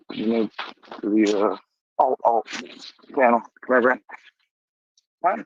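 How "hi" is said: much higher sweet greeting to the dog; high pet-talk hello. Real, not acted. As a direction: coo it up high to the puppy.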